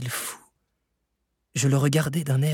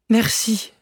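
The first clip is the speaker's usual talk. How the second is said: high exclaimed thanks; grateful cry; 9 semitones higher, 4 dB louder than usual. A narrator's reading. Nephew to the uncle who saved him, crying out. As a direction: cry out your thanks, pitched well up. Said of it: "m'écriai-je".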